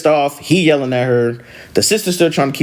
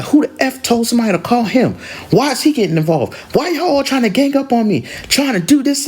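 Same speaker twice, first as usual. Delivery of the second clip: high-pitched indignant questioning; pissed off; 12 semitones higher than usual. Retold or imitated. Angry sister objecting to Dad's involvement, pissed off. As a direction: imitate a sister outraged at being ganged up on. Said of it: "So now she pissed".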